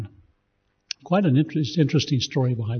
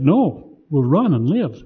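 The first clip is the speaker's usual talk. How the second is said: shouted refusal; 3 semitones higher, 6 dB louder, slower than usual. A narrator's reading. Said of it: "a veteran shouted".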